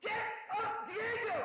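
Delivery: strained, very high drawn-out yell, held back in volume; imitated frantic yelling. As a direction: mimic a desperate cornerman. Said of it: "Yeah, he was super".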